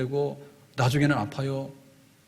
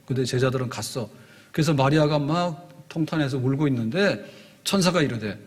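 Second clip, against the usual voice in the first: ordinary talk, nothing marked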